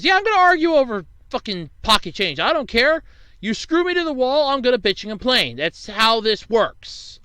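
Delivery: high, loud retold tirade; indignant comeback. Customer telling off the cashier in a retold argument, indignant. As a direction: replay a defiant rant, pitched right up.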